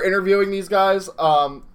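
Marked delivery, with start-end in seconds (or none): none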